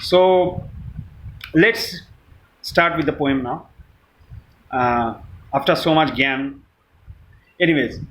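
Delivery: ordinary talk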